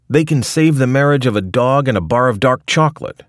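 loud, high cranky rant. Retold or imitated. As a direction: rant like a fed-up grandpa. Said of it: "rants Rick".

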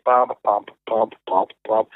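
low, even plucked-bass sounds; imitated bass notes. Musician showing how he played bass onstage, imitated low notes. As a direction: voice a plodding bass line.

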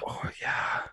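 mock Midwestern, all in a whisper; whispered Midwestern accent. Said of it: "whisper and do a Midwestern accent".

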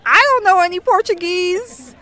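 mock-panicked outburst; retold alarm. Stranded traveler retelling a midnight breakdown, mock alarm.

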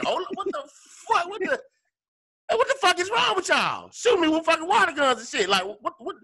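high, incredulous mock yell; high exasperated what the fuck; retold outrage. Retold outraged reaction at bar.

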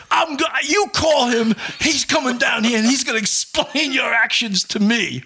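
high-pitched mock shouted order; imitated irate chief. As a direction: lay down the law as an outraged superior. Arrogant chief orders boss called.

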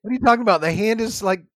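loud, high exasperated protest; indignant outburst. Real, not acted. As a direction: push back, offended and loud. Indignant argument about covering sneezes.

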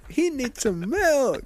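high, slow, wailing plea; freaked-out guy. Retold or imitated. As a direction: moan it like a guy losing it. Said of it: "It's like a guy freaking out".

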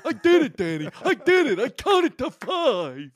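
high, slow counting swelling into loud mock triumph; Cowardly Lion-like voice. Pretend pupil proudly showing Danny the counting, hammed-up triumph. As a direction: count in a hammy put-on voice, then crow proudly. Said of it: "Have you ever played the Cowardly Lion".

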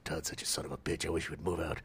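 low, quiet aside; hushed mock resentment; private grumble. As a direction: drop right down, as if behind the neighbor's back. Neighbor's private aside after fake greeting.

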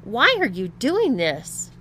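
loud, slow, exasperated question; demanding. A narrator's reading. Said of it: "demanded Tom".

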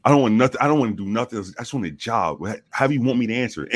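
ordinary delivery

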